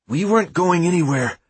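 trying to steady a shaking voice; nervous, voice barely held steady; shaky voice. A narrator's reading. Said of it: "trying poorly to steady his voice".

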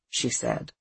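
plain reading voice

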